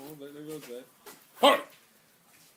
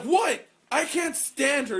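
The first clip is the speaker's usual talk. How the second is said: high, loud, disbelieving protest; a loud mock protest at the teacher; indignant outburst; 10 semitones higher, 7 dB louder, slower than usual. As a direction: throw the line out in outrage. Retold argument with teacher.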